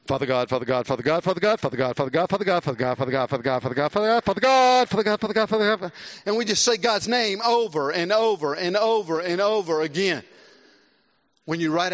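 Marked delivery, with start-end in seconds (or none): 0.1-5.9 lightly parodied repetitive praying, chanted on and on; mock rambling prayer. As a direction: ham up a filler-word prayer, droning the name. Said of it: "This might be what it sounds like".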